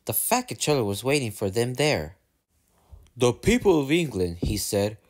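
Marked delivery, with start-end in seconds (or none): 3.1-4.4 formal, put-on controller's voice; pompous announcement. A narrator's reading. Fat Controller addressing his engines, pompous. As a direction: announce it like an official speech.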